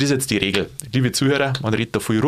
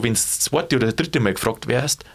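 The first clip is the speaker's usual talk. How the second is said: ordinary delivery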